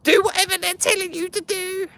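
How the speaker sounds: very high mocking mum voice; fussy mother nagging in falsetto; imitating his mother. Friend's mother telling him to cooperate with police, high mock voice.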